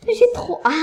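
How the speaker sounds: high, loud, breathy squeal of eagerness; real excitement. Real, not acted. A kid tells viewers about the coming house, squealing with excitement. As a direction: burst out that you can't wait.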